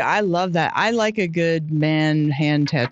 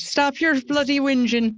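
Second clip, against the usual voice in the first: higher-pitched imitation of an Irish mother; mother's Irish accent; 6 semitones higher than usual. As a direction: scold like a mum with an Irish accent. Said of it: "It was the Irish accent".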